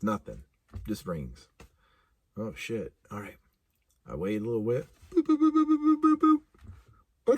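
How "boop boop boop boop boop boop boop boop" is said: high, squeaky dial tones; keypad beeps. Retold or imitated. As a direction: mimic punching in the number. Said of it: "dialing again".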